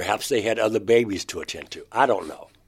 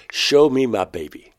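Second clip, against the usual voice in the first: retold demand, a little raised; 3 semitones higher, 6 dB louder than usual. [name] demanding Crescent show him the baby, insistent. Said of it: "I think I had to scream it out".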